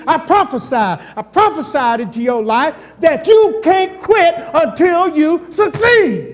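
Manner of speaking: loud, rising preacher's declaration; preaching. Acted. Preacher prophesying over the congregation, loud and rising. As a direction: proclaim it over them, slowing at the end.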